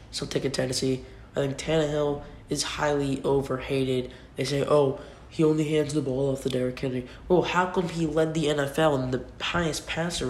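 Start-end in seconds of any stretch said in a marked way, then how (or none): none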